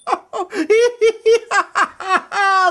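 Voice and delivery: high, long hearty laughter; big belly laugh. Acted. Flower man being tickled, hearty laughing. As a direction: laugh till you can't stop. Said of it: "The flower man laughed and laughed".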